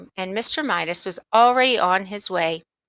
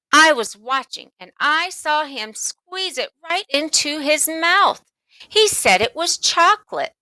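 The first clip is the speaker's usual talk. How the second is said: lightly voiced girl, higher, louder as she insists; a hint of a tattling girl; insistent little sister; 8 semitones higher, 3 dB louder than usual. Sister insisting to mother she saw him, high and indignant.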